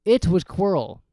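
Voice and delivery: usual speaking voice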